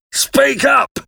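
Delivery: high, forceful convict command; menacing shout. A narrator's reading. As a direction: order the boy sharply.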